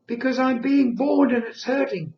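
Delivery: put-on high, loud wailing baby voice. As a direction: cry it out like a newborn in pain. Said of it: "in this baby voice".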